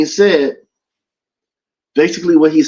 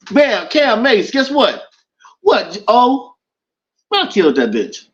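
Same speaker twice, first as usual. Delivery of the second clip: a hint of a gleeful O J, higher and slow at first; mock O J confession; 6 semitones higher than usual. O J telling the show hosts, mock boastful confession. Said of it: "if O J was on".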